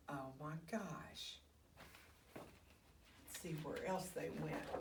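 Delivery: hushed, slow whisper; whispered aside. Real, not acted. Storyteller aside to children, whispering. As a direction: lean in and whisper.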